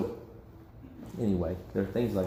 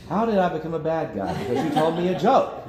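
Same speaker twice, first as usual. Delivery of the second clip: mock exasperation; 8 semitones higher, 6 dB louder than usual. Voicing the offended listener.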